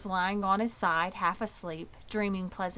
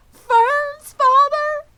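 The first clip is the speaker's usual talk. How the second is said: very high, loud, slow wail; 16 dB louder, much slower than usual. Acted. Wilbur hears Fern's father is in on it, wailing very high. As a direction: wail it out heartbroken.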